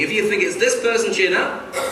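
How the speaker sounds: ordinary talk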